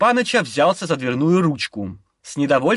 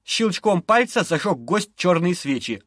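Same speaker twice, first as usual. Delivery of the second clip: plain reading voice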